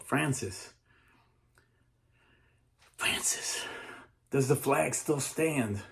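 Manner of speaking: distant calling, slow and pleading; slow drawn-out call from far off; yelling up. Retold or imitated. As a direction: call up from below to Francis. Soldiers yelling up from prisons.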